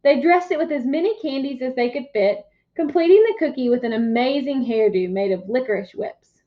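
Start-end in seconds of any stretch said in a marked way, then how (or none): none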